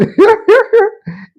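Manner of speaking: loud, high burst of laughter; real laughter. Real, not acted. Laughing before retelling.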